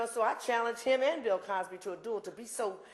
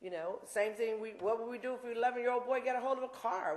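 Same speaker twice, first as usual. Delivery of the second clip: usual speaking voice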